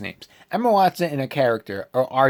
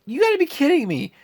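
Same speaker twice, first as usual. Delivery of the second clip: higher, exasperated cry of disbelief; can't believe what you're watching; 12 semitones higher than usual. Retold or imitated. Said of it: "I was just like".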